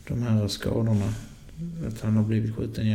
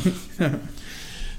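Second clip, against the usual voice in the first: short laugh; 8 semitones higher than usual. Real, not acted. Laughs at cyclist remark.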